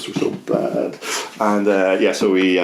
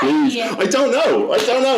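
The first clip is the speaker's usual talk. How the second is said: high, strained pleading; exasperated plea; 14 semitones higher, slower than usual. Retold or imitated. Telling a friend about the stuck song, high exasperated pleading. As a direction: beg the song in your head to stop.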